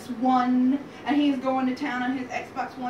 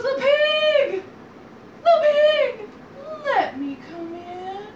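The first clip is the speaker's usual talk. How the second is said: loud, high, drawn-out wolf call easing off at the end; insistent big bad wolf; 12 semitones higher, 5 dB louder, slower than usual. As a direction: sing out a demand to be let in. Wolf demands entry again.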